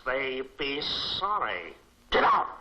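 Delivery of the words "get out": loud furious dismissal; loud angry order to leave; angry shout. Acted. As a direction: throw him out with a yell.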